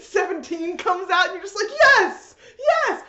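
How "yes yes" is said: high, loud cheer of joy. Retold or imitated. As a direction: shout it like your team scored. Fans cheering Vegeta's entrance.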